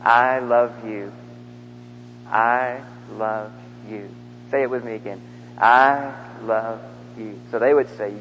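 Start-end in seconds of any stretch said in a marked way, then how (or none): 0.0-4.1 low, very slow, drawn-out words; slow, tender. Shown as a demonstration. Preacher leads the congregation in repeating, slow and tender. 5.6-7.4 low, very slow, drawn-out words; slow, tender. Shown as a demonstration. Preacher leads the congregation in repeating, slow and tender.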